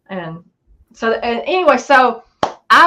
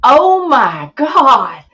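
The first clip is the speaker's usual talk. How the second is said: freaked out; 4 semitones higher, 6 dB louder, much slower than usual. Retold or imitated. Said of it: "She just freaked out".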